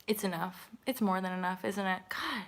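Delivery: ordinary talk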